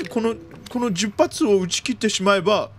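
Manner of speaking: normal talking voice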